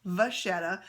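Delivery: French-style "vachetta", ch as in vache; French pronunciation. Shown as a demonstration. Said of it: "I am gonna start saying".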